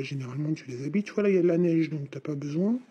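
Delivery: normal talking voice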